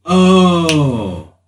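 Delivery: ordinary talk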